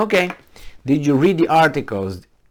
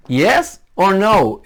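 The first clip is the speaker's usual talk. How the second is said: slow, loud and higher, pressing hard; demanding yes or no; 9 semitones higher, 6 dB louder, much slower than usual. Real, not acted. Blogger demanding a straight answer from Ukrainian officials, slow and loud. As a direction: hammer each word to force an answer.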